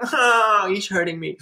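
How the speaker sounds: high pitched fake wail that drops to a whimper; mock victim cry. Pastor mocking a parasite who cries out while stabbing. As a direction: ham up a wounded cry, then go small. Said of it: "they cry out as they stab you".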